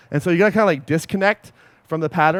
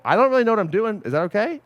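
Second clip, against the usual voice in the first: higher-pitched, tentative admission ending in a question; unsure admission; 6 semitones higher than usual. Retold or imitated. Coach telling early clients he is still learning, unsure. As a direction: ask permission, higher and unsure. Admits inexperience to his clients.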